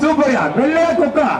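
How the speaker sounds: usual speaking voice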